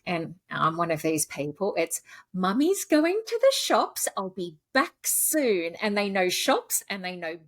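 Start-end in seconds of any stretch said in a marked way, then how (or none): 2.3-5.7 lilting pet-parent tune, high then slowing; sing-song voice. Retold or imitated. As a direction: sing it out to the dogs, leaving. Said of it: "I say it in the same sing-song voice".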